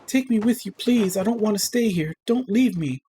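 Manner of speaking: high, tearful begging; cling to them through tears; crying plea. A narrator's reading. Dying girl begging her family in tears. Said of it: "Her cries mingle with tears".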